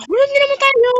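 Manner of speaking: high panicked yell; startled shout. Real, not acted. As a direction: shriek it out. Startled during game.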